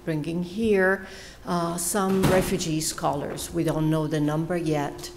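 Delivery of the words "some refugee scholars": usual speaking voice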